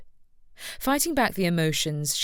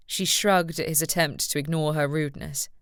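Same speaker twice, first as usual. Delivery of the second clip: ordinary delivery, nothing marked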